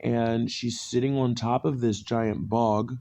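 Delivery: ordinary talk, nothing marked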